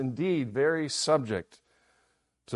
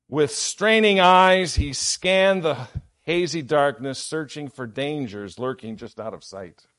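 dramatic story reading; 7 dB louder than usual. Acted. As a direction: read it like a tense sea tale. Preacher reads dramatic illustration aloud.

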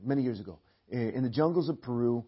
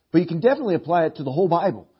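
normal talking voice

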